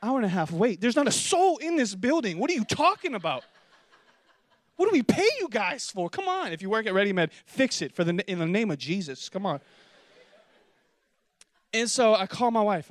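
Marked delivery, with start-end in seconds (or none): 0.0-6.5 higher, exasperated complaining; mock outrage at the front desk; indignant rant. Patient ranting at the clinic staff, indignant.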